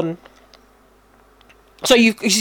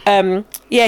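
normal talking voice

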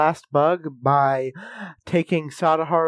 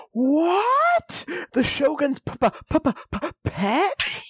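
much higher, stuttering shock; stunned stammer; slower than usual. Retold or imitated. Gintoki hears the beetle is the Shogun's, stunned stammer. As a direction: choke on the word in disbelief. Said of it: "Gintoki says".

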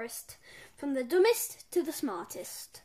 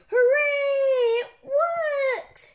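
high, loud triumphant cheer; excited cry; 11 semitones higher, 11 dB louder, much slower than usual. A narrator's reading. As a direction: shout it like an inventor whose machine works. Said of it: "cried Professor Poopy Pants".

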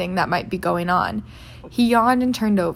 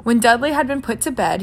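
ordinary delivery, nothing marked

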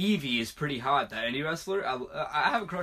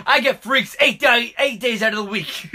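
wrestling promo; 8 semitones higher, 10 dB louder than usual. Pretend. Mock wrestler catchphrase, laughing.

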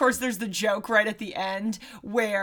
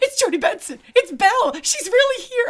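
high, gushing re-enacted thrill; giddy fan reaction; fan excitement; 11 semitones higher, 5 dB louder than usual. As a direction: squeal it like a starstruck fan, pitch climbing. Re-enacting delight at hearing the actresses.

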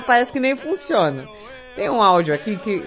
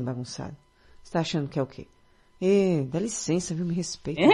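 quiet scolding; 2 semitones lower, 9 dB quieter than usual. Real, not acted.

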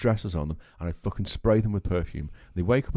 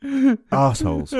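contemptuous; 5 semitones higher, 6 dB louder, slower than usual. Real, not acted. Contemptuous insult, slow.

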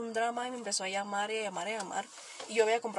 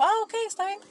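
high mock friend reply; 10 semitones higher, 3 dB louder than usual.

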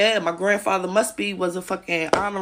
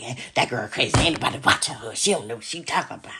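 low dismissive mockery; 7 semitones lower, 3 dB quieter, faster than usual. Imitating people calling her crazy, low and dismissive. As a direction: lower and softer, brushing her off. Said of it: "they like".

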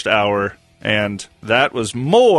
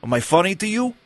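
Goodfellas menace; 4 semitones higher, 4 dB quieter, faster than usual. Retold or imitated. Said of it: "nonverbal De Niro impressions".

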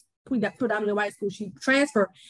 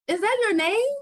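incredulous customer; 8 semitones higher than usual. Retold or imitated. Acts out customers querying the name.

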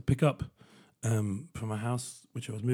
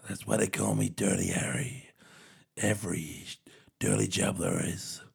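softer, lower, imitating a tough-guy growl; macho Dirty Harry; 5 semitones lower, 5 dB quieter than usual. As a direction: do a lightly voiced Dirty Harry. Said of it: "he says in a macho voice".

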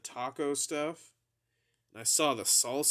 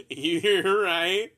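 laughing; 6 semitones higher, 9 dB louder than usual. Retold or imitated. Laughing concession.

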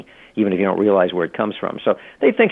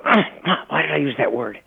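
higher-pitched mock groan of regret; exasperated self-critic; 5 semitones higher than usual. Author rereading his own paragraph and balking at a word, exasperated. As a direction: wince at your own mistake, voice pitched up.

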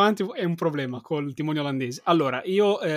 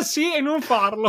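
laughing; 9 semitones higher, 3 dB louder, slower than usual. Real, not acted. Ridendo della ricerca su Google.

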